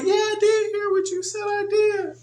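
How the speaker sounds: put-on very high, whiny child protesting; child insisting he heard; protesting kid. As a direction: squeal back in defence like a kid. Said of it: "they be like".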